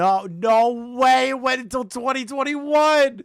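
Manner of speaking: high, drawn-out disbelief; stunned, stretching the words out; shocked disbelief. Real, not acted. Shocked at the dates.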